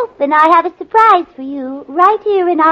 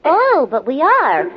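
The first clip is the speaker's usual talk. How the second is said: usual speaking voice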